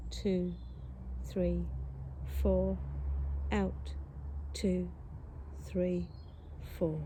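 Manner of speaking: slow, spaced count through in, hold and out; calm counting cue with a held breath. Shown as a demonstration. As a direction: guide the inhale, hold and exhale by count. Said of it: "So if your count is four".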